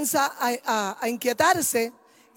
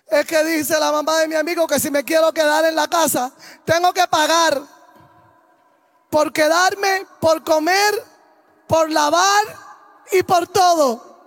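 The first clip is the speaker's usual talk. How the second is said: higher, louder complaining list; whining son; 3 semitones higher, 5 dB louder than usual. Retold or imitated. Son complaining to his mother about rent, higher whining list. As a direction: whine it out, building.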